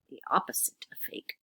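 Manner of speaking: hushed teacher aside; whisper. Shown as a demonstration. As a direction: lean in and whisper the clue.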